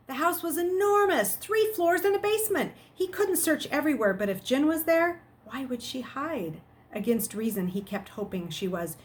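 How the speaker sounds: steady read-aloud storytelling; story narration. A narrator's reading. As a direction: read the chapter to the class. Reads chapter aloud to students.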